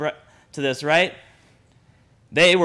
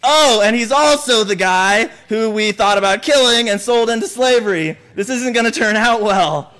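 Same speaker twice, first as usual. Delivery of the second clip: higher, half-laughing worried brothers; nervous brothers; 4 semitones higher than usual. Retold or imitated. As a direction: play the guilty brothers dreading it, with a laugh. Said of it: "now they're going".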